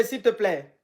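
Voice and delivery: ordinary talk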